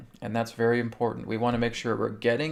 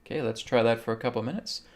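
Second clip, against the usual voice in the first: ordinary delivery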